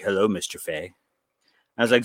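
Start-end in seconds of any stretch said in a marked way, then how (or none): 0.0-0.9 low, soft, lightly creepy voice; creepy greeting. Retold or imitated. Villain petting the dog greets dreamer, creepy. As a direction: greet softly like a lurker in shadows. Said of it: "very creepy like".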